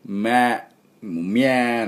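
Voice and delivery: "ま" coloured like "みゃー"; "みゃー" ae vowel. Shown as a demonstration. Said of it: "このAの音がおみゃーの音".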